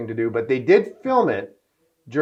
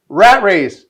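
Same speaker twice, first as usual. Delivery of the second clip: louder, higher, proud title call; loud mimic of Cuba; 7 semitones higher, 12 dB louder than usual. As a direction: announce the title loudly in a tipsy star's voice. Cuba naming his movie.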